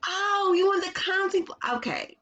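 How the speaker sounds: high, bright mock-surprised mom; light up with delight; delighted surprise. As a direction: mom thrilled her toddler asked clearly. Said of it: "I said".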